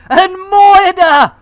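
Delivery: loud, high, drawn-out holler; big streamer shout-out; hyped name call. Real, not acted. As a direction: holler the viewer's name slowly. Calls out a viewer's name.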